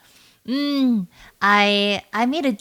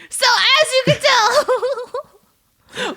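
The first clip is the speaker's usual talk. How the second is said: laughing mock outrage; 10 semitones higher, 3 dB louder than usual. Mock outrage defending herself, laughing.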